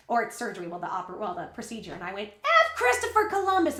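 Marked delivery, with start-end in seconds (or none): 2.4-3.8 high, louder mock outburst; retold outburst. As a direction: burst out indignantly, acting out a groggy tirade. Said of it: "And I went off".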